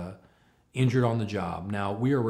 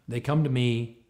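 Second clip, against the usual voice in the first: ordinary talk, nothing marked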